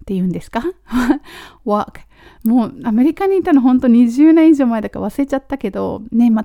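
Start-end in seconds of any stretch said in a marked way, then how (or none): 1.6-2.0 "walk" with an open ah, like "wahk"; American pronunciation. Shown as a demonstration. Teacher trying "walk" after a laugh, American pronunciation. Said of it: "アメリカだと".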